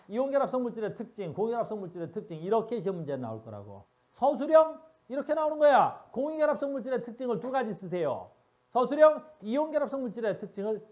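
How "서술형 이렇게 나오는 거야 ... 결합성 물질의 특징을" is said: much louder and higher, calling out the question; loud, high teacher warning about the exam. Real, not acted. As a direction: announce it like the test is coming. Announcing the exam question loudly.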